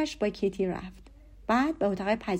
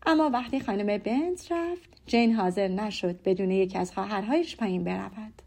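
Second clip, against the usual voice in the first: ordinary delivery, nothing marked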